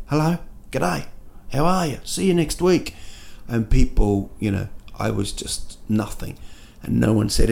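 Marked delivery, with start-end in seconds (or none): 0.1-2.9 high, soft chirpy hellos; friendly greetings. Retold or imitated. Newcomer to London greeting strangers, imitated friendly hello. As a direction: greet passers-by lightly, pitched up. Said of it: "I was walking along, sort of saying".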